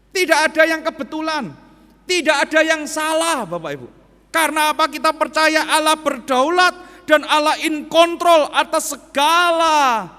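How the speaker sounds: loud, high proclaiming, slowing at the end; preaching at full force. Acted. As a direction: preach it out to the whole hall. Preaching climax to congregation. (khotbah berapi-api, keras dan tinggi)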